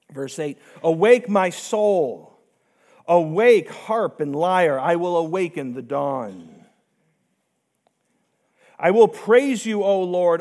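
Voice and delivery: slow, measured reading of a psalm; scripture reading. Acted. Pastor reading Psalm fifty-seven to the congregation, slow and reverent. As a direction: read the verses out with reverent weight.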